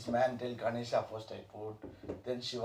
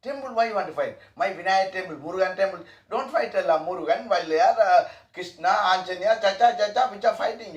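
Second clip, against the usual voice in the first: louder, higher preaching voice; fervent preaching; 6 semitones higher, 8 dB louder than usual. Acted. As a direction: urge them like a preacher. Teacher preaching with force.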